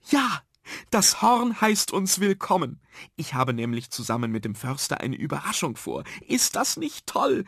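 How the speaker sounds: high-pitched, delighted announcing; enthusiastic teacher. Acted. Teacher announces a surprise to the children, enthusiastic. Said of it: "bevor sie begeistert in die Hände klatschte".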